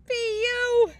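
high, loud pinched nose. Acted. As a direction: hold your nose and squeak. Skunk stink reaction.

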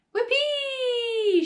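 high, loud, drawn-out whoop; whooping shout. A narrator's reading. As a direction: whoop with wild glee. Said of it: "she shouted".

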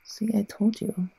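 smug hushed whisper. Acted. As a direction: whisper it knowingly.

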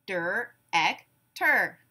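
"Der-ek-ter" in three slow, separate beats; syllable by syllable. Shown as a demonstration. Class breaks the word apart together, syllable by syllable. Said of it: "break it apart into syllables".

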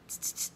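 three short tongue clicks; monkeys' mocking tsks. A narrator's reading. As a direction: click back like copycat monkeys. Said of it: "back at him and said".

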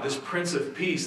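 usual speaking voice

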